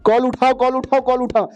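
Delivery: high-pitched, insistent phone alert; talking ringtone. Acted. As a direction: chant it like a nagging ringtone. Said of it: "जोर से call बजने लगता है". (ऊँची आवाज़ में बजती रिंगटोन)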